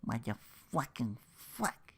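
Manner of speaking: ordinary delivery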